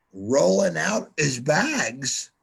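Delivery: ordinary delivery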